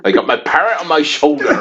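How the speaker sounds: put-on high, laughing pirate with Devon accent; playful West Country pirate voice. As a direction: ham it up as a comic pirate. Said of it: "You sound like you're in Devon".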